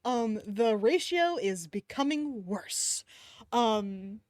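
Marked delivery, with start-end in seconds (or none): none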